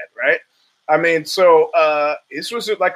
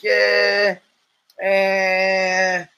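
lightly voiced puzzled detective hum; a hint of a musing TV detective pausing; Columbo; 3 semitones higher than usual. Thinking through the Irish question, mild musing detective voice. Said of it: "I start doing my Columbo thing".

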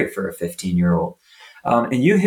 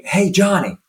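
high, sharp reproach; scolding coach; 8 semitones higher than usual. Retold or imitated. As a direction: call the kid out for the miss. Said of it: "Instead of saying".

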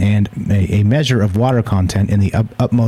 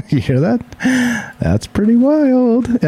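high mock voice; 13 semitones higher, much slower than usual. Reacting to a weird sound, mock voice.

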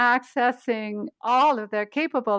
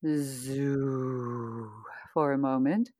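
deep, soft zoo; low zoo; 8 semitones lower, 6 dB quieter, much slower than usual. Shown as a demonstration. Coach leads the low zoo warm-up. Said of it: "let's do our".

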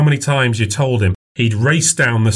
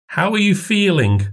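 put-on older woman voice; 10 semitones higher than usual. A narrator's reading. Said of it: "mother asks".

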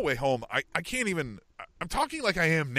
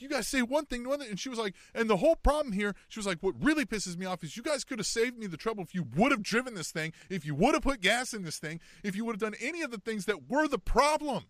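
exasperated wife; 8 semitones higher than usual. Retold or imitated. Wife scolding the dealership.

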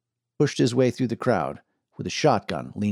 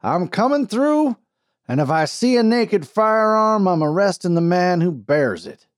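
booming; 9 semitones higher, 5 dB louder than usual. A narrator's reading. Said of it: "boomed a man's voice".

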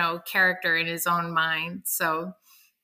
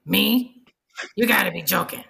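gruff biker pig. Acted. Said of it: "biker with a pack-a-day habit".

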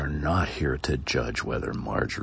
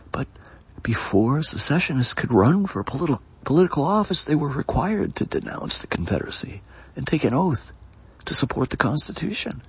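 a lightly voiced prosecutor, pitched higher; prosecutor's argument; 11 semitones higher, faster than usual. Prosecutor presses the judge, lightly voiced and higher.

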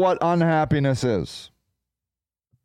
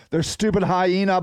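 normal talking voice

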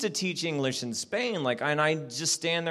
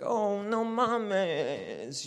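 drawn out; 5 semitones higher, 6 dB quieter, much slower than usual. Retold or imitated. To the orphan, a very slow drawn out reply. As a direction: stretch it slowly, very slow.

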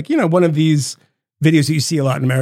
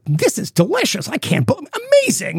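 Tucker gushing; 12 semitones higher than usual. Retold or imitated. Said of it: "he's like".

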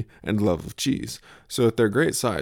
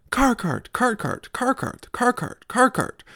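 put-on much higher, chanting excited toddler. Imitating the toddler who spots the car cart, high toddler chant. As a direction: squeak it like a giddy toddler spotting a car cart.

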